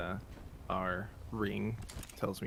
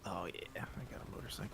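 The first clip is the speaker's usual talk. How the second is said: whispering; 8 dB quieter than usual. Real, not acted. Quiet aside during the game.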